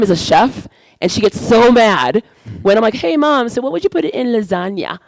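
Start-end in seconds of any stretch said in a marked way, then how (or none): 2.9-5.0 mock innocent question. Asks mom, acting innocent.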